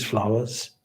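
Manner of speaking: usual speaking voice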